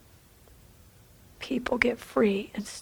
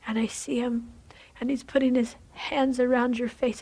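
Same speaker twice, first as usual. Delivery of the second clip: usual speaking voice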